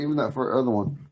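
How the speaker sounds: ordinary talk, nothing marked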